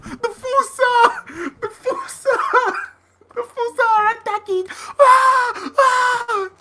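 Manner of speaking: high-pitched cartoon wailing; mock panic. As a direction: cry the alarm in a silly high voice. Sketch replay of panicked lemur crowd.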